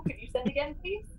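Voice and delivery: ordinary talk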